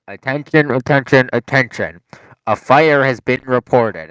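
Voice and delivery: loud automated warning, slowing at the end; imitated alarm announcement. Alarm interrupting a student reading, loud mimicked announcement voice. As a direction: boom it out like a recorded fire warning. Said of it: "like, it goes".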